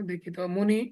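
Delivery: ordinary talk, nothing marked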